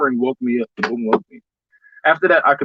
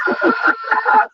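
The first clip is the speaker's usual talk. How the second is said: mock scream. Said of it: "You're like".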